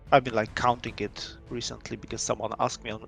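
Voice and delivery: usual speaking voice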